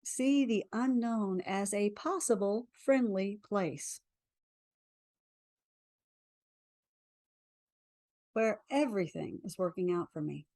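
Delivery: guided affirmation. Acted. Leads a tapping affirmation.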